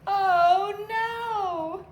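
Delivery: hammed-up storybook alarm; mock dismay. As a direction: gasp it like a storyteller feigning shock. Button lost.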